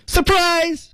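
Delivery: very high-pitched performed "Surprise!"; surprise shout. Shown as a demonstration. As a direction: call it out high, held on the last syllable. Demonstrating the cartoon line. (高声喊出惊喜)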